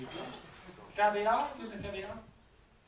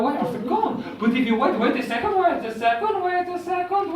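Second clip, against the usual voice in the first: usual speaking voice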